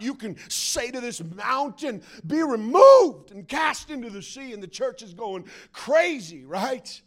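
loud preacher's proclamation, swelling on REMOVED; mock faith-preacher fervor; hyped-up preacher. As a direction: belt it out to excite the church. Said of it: "preaching it in a way that is ungodly".

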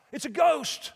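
hammed-up shriek of fear; terrified mock scream. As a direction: cry out like a frightened fisherman who sees a ghost. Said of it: "It said they screamed".

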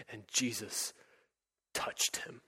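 soft, breathy whisper; hushed whisper. Real, not acted. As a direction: drop to a hush to close the story. Climax of the sermon reading.

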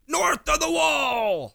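slow, high, booming proclamation; dramatic TV quote. Retold or imitated. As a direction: announce it like an epic saga. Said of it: "they keep saying".

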